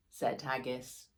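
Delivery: plain reading voice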